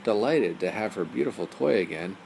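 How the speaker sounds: usual speaking voice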